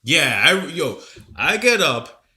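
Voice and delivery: normal talking voice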